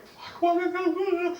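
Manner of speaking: very high, drawn-out whimper; comically high, wailing alarm; startled dismay. Retold or imitated. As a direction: squeal it in mock panic. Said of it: "I'm like".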